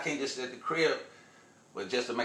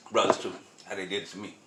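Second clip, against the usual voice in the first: ordinary delivery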